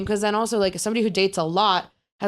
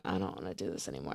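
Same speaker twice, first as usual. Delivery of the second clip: mumbled aside; 13 semitones lower, 16 dB quieter, faster than usual. Retold or imitated. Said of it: "they're like".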